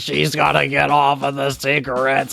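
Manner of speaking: raspy chain-smoker grandma, slow; husky old smoker grandma. Pretend. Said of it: "what grandma sounds like that?".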